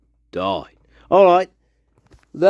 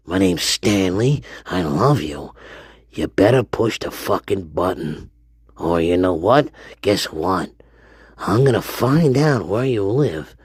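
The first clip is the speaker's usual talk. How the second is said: low creepy voice; 11 semitones lower, 4 dB quieter than usual. Acted. Low menacing persona.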